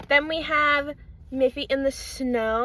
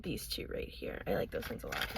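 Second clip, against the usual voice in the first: whispering; 11 dB quieter, faster than usual. Real, not acted. Drifts into a whisper.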